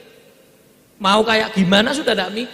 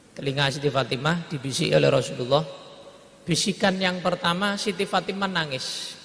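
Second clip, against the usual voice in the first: ordinary delivery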